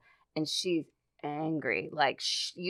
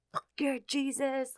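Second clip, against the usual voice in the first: slow, high, hushed possessed voice; voice not her own; 9 semitones higher, 5 dB quieter, slower than usual. Retold or imitated. Possessed girl cursing at the pastor, in an unnatural high voice. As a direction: imitate a possessed girl, softer and drawn out. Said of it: "in this voice that was not hers".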